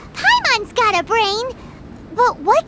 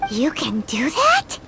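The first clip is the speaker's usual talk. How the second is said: lower, slow, hushed amazement; awed whisper; 9 semitones lower, slower than usual. Acted. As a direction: breathe it out in awe. Amazed by the demonstration.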